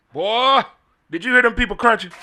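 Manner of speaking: high, loud delight with a laugh. Real, not acted. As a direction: gush about the crunch. Delight over fried chicken.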